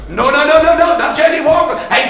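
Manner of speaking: ordinary talk, nothing marked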